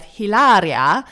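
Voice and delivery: Hilaria drawn out loud in mock Spanish; mock-Spanish flourish on Hilaria; put-on Spanish accent. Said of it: "quite an offensive accent".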